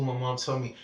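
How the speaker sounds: ordinary delivery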